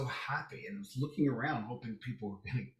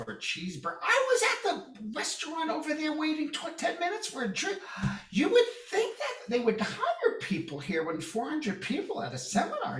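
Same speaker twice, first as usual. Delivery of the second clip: much higher, whiny complaining woman; mock the petty gripes, pitched high with a laugh; 10 semitones higher than usual. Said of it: "one woman turns to the other".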